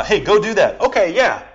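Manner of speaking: mock parent and child exchange; casual brush-off. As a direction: toss off a cheerful yes. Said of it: "it could be this".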